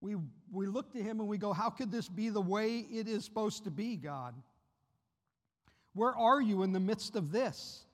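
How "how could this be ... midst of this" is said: anguished cry. Acted. Preacher voices anguished plea to God.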